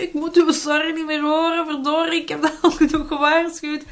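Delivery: high, loud exasperated scolding; annoyed parent. Retold or imitated. Parent fed up with the child's sorries, loud exasperated scolding. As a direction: let the irritation boil over.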